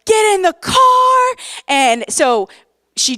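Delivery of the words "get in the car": high, loud urgent order; urgent command. Retold or imitated. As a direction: bark it out in crisis mode. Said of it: "I'm like".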